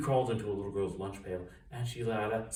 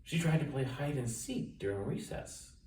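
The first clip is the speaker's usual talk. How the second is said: ordinary delivery, nothing marked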